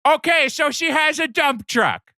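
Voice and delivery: put-on very high old lady falsetto; Norma Bates. Mother admitting she was wrong, mock-grudging old lady. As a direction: squeal a grudging, hammed-up concession. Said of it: "you just hear over the P A".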